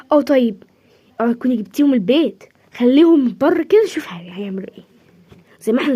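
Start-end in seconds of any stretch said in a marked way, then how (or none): 3.9-4.8 whispered aside. Acted. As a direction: hiss it under your breath so the caller won't hear. Hushed aside during phone call. (همس خافت)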